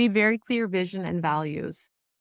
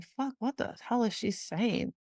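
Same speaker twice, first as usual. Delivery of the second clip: higher, slowed mock bafflement; confused exasperation; 5 semitones higher, 4 dB quieter than usual. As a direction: imitate a lost listener, half-joking. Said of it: "two people around me are like".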